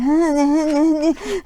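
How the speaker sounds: high, loud, panicky wordless gasping; mock hyperventilating. Warning her boss about a spider, imitated speechless hyperventilation. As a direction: gasp fast as if unable to speak. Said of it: "I'm hyperventilating. I'm, I couldn't even speak".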